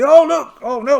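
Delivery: loud, high "Oh, look!"; excited cry. Acted. Pirate spotting damage to his ship, alarmed shout.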